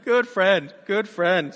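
sarcastic. Retold or imitated. Friend betrayed him.

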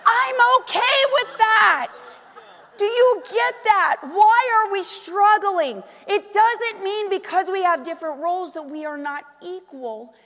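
high, loud pulpit delivery; forceful preaching. Real, not acted. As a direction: drive each point home to the congregation.

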